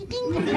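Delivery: high, soft "ding"; tiny guitar plink. Acted. Comic mimicking his little guitar, high soft plink. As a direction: imitate a little string being plucked.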